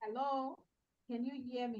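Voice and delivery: faint voice far from the microphone; almost lost, too far from the mic; barely audible. Real, not acted. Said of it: "Your voice is very low".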